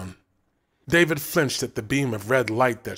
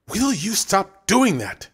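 irritated, slowed-down scolding; exasperated; 8 semitones higher, 3 dB louder, slower than usual. A narrator's reading. Dina scolding Brent for pounding the wall, irritated. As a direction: snap at him to stop.

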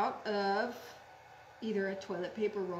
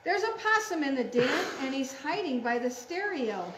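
quick, urgent picture-book alarm; excited story reading; 3 semitones higher, 6 dB louder than usual. Acted. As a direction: announce the intruder in a hurry.

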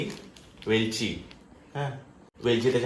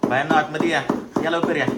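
higher-pitched call to come in; 5 semitones higher, 3 dB louder than usual. Real, not acted. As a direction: call them inside, pitched up. Calls the women inside.

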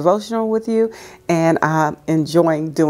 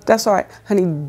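ordinary delivery

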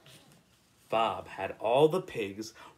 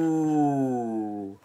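a drawn cow moo; a lightly voiced cow moo. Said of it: "What sort of animal makes this noise".

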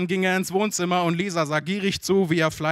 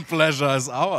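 laughing echo. Real, not acted. Guest echoes the phrase laughing.

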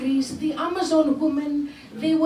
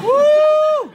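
whoop; 11 semitones higher, 10 dB louder than usual. Real, not acted. Crowd cheer after spanky.

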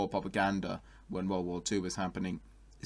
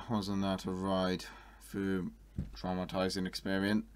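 ordinary talk

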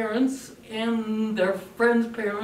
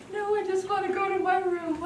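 higher sulky reply; a sulking daughter; sulky teen; 11 semitones higher than usual. Retold or imitated. Teen daughter answering mom.

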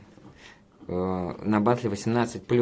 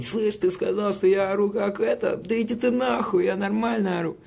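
[name]'s yelling; 11 semitones higher than usual. Retold or imitated. Imagined rapper's retort about his yelling, mock yell. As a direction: put on an indignant high-pitched holler. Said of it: "он бы наорал такой".

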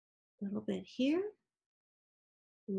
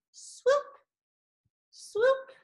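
playful swoop; 12 semitones higher than usual. Pretend. Mimics the brush stroke swooping.

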